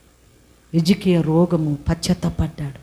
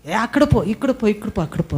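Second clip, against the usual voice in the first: high scolding crowd voices; mocking crowd; 8 semitones higher, faster than usual. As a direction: hush the blind man, mock-scolding. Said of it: "అని జనాలందరూ".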